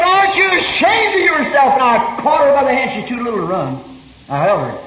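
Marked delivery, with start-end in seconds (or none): 0.0-1.7 higher, raised rebuke; retold rebuke. Preacher retelling his rebuke of the women, raised voice. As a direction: scold her as you did that night. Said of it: "I said".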